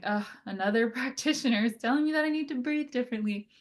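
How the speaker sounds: quick, mock-exasperated "ugh"; mock eye-rolling complaint; eye-roll weariness. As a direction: imitate a client who has heard it all before. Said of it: "kind of an eye roll thing".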